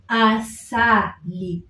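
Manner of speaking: asali said very slowly, each a open; Swahili asali. Shown as a demonstration. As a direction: stretch asali so every a is heard. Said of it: "the a still comes in".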